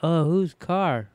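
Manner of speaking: teasing imitation of the son; mocking Flynn. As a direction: drag it out like a dim kid. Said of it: "You go".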